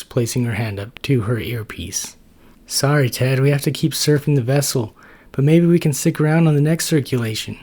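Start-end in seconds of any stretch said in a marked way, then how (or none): 2.7-7.6 higher, louder, fast apologetic rush; hurrying off mid-call; Natasha the neutrophil. Acted. Natasha voiced.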